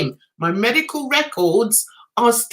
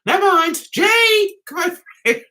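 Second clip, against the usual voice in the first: mock calling a child; 7 semitones higher than usual. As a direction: call the kid over, tripping and giving up. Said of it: "I'd never be able to call my kid".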